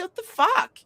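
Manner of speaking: shocked high-pitched what the fuck; shocked outburst. Retold or imitated. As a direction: blurt it out high and stunned. Her retold shocked reply.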